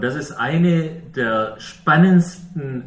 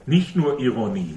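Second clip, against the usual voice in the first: ordinary talk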